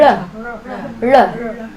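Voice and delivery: "R" as an L with tongue curled back and up; retroflex L. Shown as a demonstration. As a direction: pull the tongue back so the L nears an "R". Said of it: "your tongue's going back and up".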